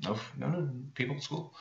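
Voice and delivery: put-on soft little-girl reply, trailing very quiet; a hint of a child, murmured; lightly voiced little girl. Little girl answering her mom, lightly voiced and soft. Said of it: "And the little girl goes".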